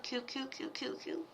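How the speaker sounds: soft murmured cooing to a cat; cooing softly. Real, not acted. Cooing softly to her kitty on camera. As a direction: coo it almost under your breath.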